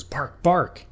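high, yappy double bark; angry dog barks. Acted. Odie barking back at Garfield, annoyed. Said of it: "gets a little angry and he goes".

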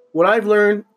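said on a loud burp; burping the words. Real, not acted. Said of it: "I let that one out loud".

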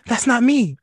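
high, loud protest in mock alarm; mock panicked denial. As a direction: blurt it out high, acting spooked. Acting out a Ouija board scare.

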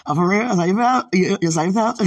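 very loud, high, hammed-up challenge; mock outrage. Teasing sweetheart over old prom pictures, mock outraged. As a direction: play offended at full volume, breaking into laughter. Repeats mock reaction, bigger, then laughs.

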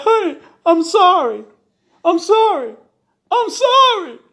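high, loud wailing apology; tearful begging. A narrator's reading. Husband in tears apologises to his wife, wailing.

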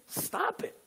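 high, soft "stop it"; demonstrated command. Shown as a demonstration. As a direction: say "stop it" high and lightly, without force. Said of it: "turn to your neighbor and say".

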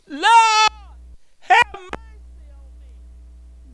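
high, sobbing wail for mercy; crying out. Retold or imitated. David fallen on his face, wailing to God for mercy. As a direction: wail it like a broken man begging. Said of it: "He cried out to the Lord".

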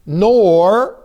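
"nor" loud and drawn out; stress on nor. Shown as a demonstration. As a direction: punch "nor" and hold it alone. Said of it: "there's a second negative".

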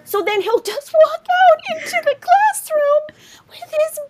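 ordinary talk

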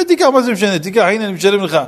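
high, pushy acted insistence; insistent payer. Payer insists on empty payment.

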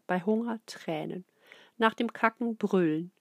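ordinary delivery, nothing marked

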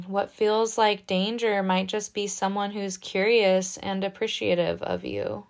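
ordinary talk

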